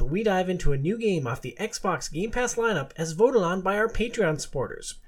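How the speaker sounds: ordinary talk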